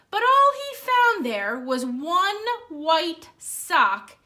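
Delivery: lively storybook read-aloud; reading to children. Acted. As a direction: read it like story time for kids. Reads picture book aloud.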